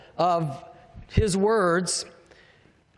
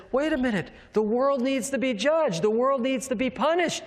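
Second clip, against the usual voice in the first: higher-pitched pleading, without real tears; mock distraught protest; 6 semitones higher than usual. John protests that the world must be judged, mildly acted distress. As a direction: act out John losing it, pitched up. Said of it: "He just starts crying because John says".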